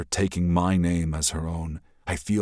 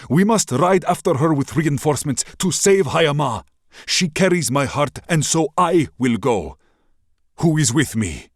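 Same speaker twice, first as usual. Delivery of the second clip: high, loud speech to the crowd; loud address; 10 semitones higher, 7 dB louder than usual. Acted. Prince rallies the people of Ashana, loudly. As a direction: call out to the whole city.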